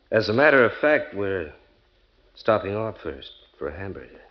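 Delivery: ordinary delivery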